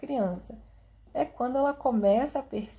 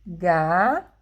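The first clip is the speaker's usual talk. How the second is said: put-on child counting syllables; 3 semitones lower, 6 dB louder than usual. Shown as a demonstration. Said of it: "ela vai contar".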